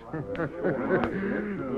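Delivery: sly mocking villain chuckle; villain laugh. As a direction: laugh off the defeat with menace. Defeated villain laughs at hero.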